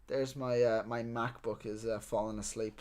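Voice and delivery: ordinary delivery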